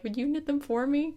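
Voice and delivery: high, sweet little ask; sweet request. Retold or imitated. Grandma asks granddaughter to knit socks, high and sweet.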